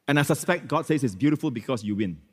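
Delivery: ordinary delivery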